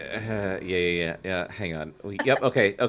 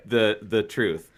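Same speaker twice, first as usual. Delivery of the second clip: normal talking voice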